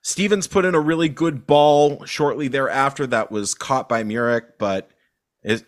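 ordinary delivery

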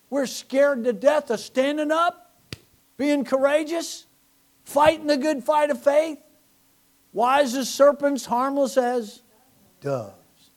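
loud, high, slow preacher's cry; impassioned sermon build; preaching at full force. Real, not acted.

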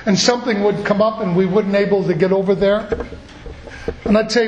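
normal talking voice

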